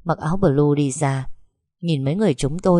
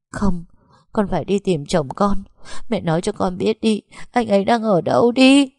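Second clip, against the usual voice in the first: pleading and demanding; desperate insistence; 5 semitones higher than usual. Acted. Daughter insisting, grieving.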